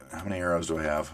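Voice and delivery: normal talking voice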